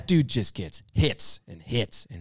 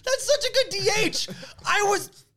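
much higher, squealing praise; excited squeal. Real, not acted. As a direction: gush as if it hurts to admit. Rival picks his favored player.

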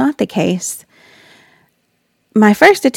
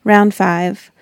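ordinary talk, nothing marked

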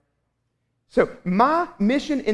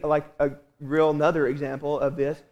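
ordinary talk

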